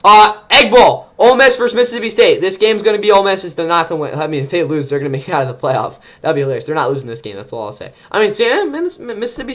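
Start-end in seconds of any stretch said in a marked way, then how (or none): none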